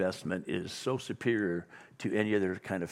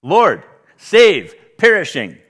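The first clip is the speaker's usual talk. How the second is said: loud, high desperate plea; frantic shout for rescue; panicked cry; 7 semitones higher, 15 dB louder, slower than usual. Retold or imitated. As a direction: cry it out like a drowning man. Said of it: "In Greek, it's three words".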